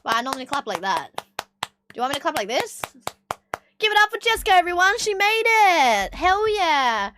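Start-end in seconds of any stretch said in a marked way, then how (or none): 3.8-7.1 high, loud cheering announcement; hype announcement. Real, not acted. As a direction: cheer her on like a host. Hyping up a friend's win.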